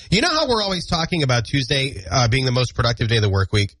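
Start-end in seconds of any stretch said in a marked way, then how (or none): none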